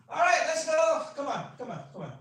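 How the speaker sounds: loud, high battle-ready call; rallying shout. Retold or imitated. Soldier rallying himself before battle, loud shout. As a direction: pump himself up to charge.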